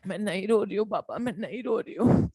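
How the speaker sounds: lightly hammed-up girlfriend pretending she isn't crying; mock sniffly denial on the phone; mock teary girlfriend. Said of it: "बंदी बोलेगी".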